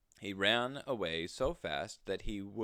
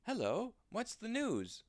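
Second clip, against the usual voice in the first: high, bright-eyed bunny voice, pitched well up; lively little rabbit. Acted. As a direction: chirp it like an eager little rabbit. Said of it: "said the lively little rabbit".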